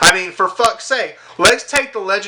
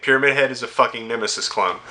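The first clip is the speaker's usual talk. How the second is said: normal talking voice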